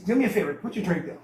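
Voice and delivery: normal talking voice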